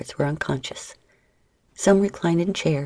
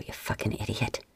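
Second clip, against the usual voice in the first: soft whispered aside, lower; smirking woman whispering to herself; whispered insult; 6 semitones lower, 11 dB quieter than usual. Acted. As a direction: hiss it under your breath with a smirk. Katie smirks, insults under breath.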